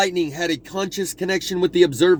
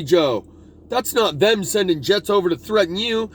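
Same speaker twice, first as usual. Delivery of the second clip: ordinary talk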